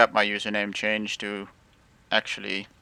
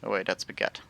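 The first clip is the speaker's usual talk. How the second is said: ordinary delivery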